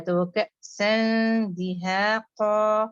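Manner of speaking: کأساً دہاقا recited very slowly and held; tajweed recitation. Shown as a demonstration. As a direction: recite کأساً دہاقا carefully, the nasal hidden. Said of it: "قاف کے لیے آپ نے اچھے سے heavy کرنا ہے". (کأساً دہاقا کو ٹھہر ٹھہر کر پڑھیں)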